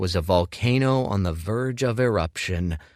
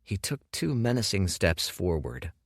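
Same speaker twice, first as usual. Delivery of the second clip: ordinary delivery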